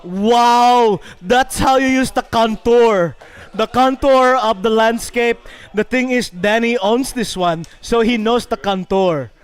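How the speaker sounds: slow, measured play-by-play; announcer voice narrating a friend's putt; golf commentary. Acted. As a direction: call the shot like a TV golf commentator.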